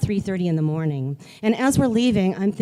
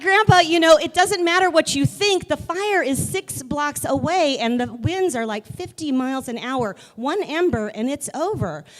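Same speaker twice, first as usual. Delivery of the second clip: exasperated; 7 semitones higher than usual. Retold or imitated. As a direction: argue him down, fed up. Arguing with grandpa.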